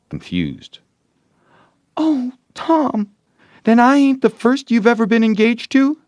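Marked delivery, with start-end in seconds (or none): 2.0-6.0 much higher, louder, wounded; tearful girl voice, dismayed; hurt girl. Acted. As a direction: cry out betrayed. Girl discovers Tom's old sweetheart.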